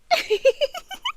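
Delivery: airy rising giggle; a quick amused titter; a soft high laugh; light giggle. Acted. Amused at listener's denial.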